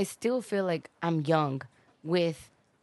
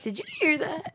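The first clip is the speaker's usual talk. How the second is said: much higher cracking squeak; 11 semitones higher than usual. Retold or imitated. Teen son whose voice cracks, high squeak. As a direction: let the voice jump up and crack.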